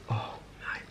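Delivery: breathless whisper of pleasure; whispered delight. Real, not acted. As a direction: hush it out, overwhelmed by taste. Savoring sashimi, breathy whisper.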